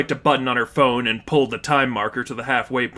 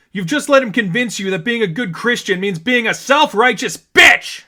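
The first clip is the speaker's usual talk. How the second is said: much higher, loud, ending in a screamed bitch; furious scream; 9 semitones higher, 5 dB louder than usual. A narrator's reading. Stephanie screaming at her friend, furious. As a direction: let it all explode. Climactic insult.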